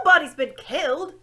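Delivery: loud, high owl hoot into a deaf ear. A narrator's reading. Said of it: "hooted the owl".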